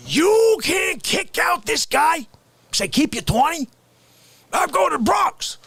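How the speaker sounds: hammed-up outrage at the driver; mock indignant rant.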